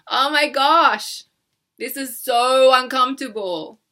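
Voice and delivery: hammed-up cringe; mock embarrassment. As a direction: act out a flustered viewer. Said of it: "if you love saying this, don't say that".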